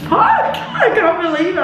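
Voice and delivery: giggling swear breaking high; laughing disbelief. Real, not acted. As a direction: laugh it out, still amazed.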